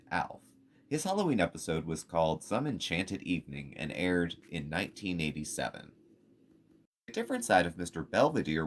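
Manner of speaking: smooth announcer-style list read; voiceover read. Acted.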